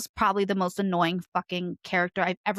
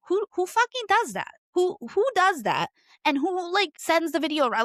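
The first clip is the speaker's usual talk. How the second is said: incredulous outrage; 9 semitones higher than usual. Real, not acted. Outraged at the cheater.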